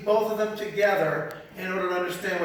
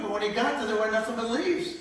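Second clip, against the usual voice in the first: normal talking voice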